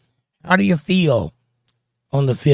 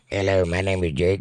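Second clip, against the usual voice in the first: low, monotone electronic voice-box imitation; mock voice-box voice; 6 semitones lower, 5 dB quieter than usual. Host jokes about needing a talking device, mock voice-box voice. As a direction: talk like a voice synthesizer, low and even. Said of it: "talk to you like this".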